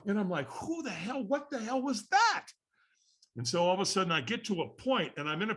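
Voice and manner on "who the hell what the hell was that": high, drawn-out disbelief; baffled reaction, pitched way up; acted stunned question; bewildered.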